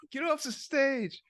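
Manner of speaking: slow, high-pitched mock heckle; mock heckler. Teasing heckler to the girl on stage, mock jeering. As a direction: drag it out in a high joking jeer. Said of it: "that's 'cause you were booing her".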